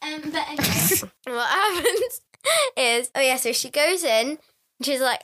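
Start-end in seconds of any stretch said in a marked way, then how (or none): none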